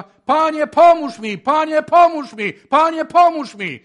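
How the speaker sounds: very loud, high, pleading cry; desperate shouted prayer. Retold or imitated. Addict in the chapel crying out to God, desperate shout. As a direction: wail for help with the whole heart. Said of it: "jego modlitwa".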